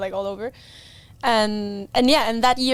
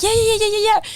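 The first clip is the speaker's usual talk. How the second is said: high, louder, rapid happy yeahs; overjoyed yeahs; 12 semitones higher, 5 dB louder, much faster than usual. Retold or imitated. Invited to try the R two, thrilled high yeahs. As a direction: burst out with thrilled repeated yeahs. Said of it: "And I was so happy".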